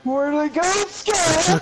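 high, loud, frightened calling out; yelling for a lost friend in the dark; panicked call. Real, not acted. Lost player calling out to Scott, high panicked yell.

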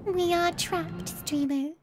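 high, slow, eerie voice; creepy trapped voice. Retold or imitated. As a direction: moan like something trapped. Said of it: "that's the kind of voice that chat has".

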